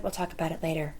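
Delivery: ominous quiet. Retold or imitated. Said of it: "he hates when I say that".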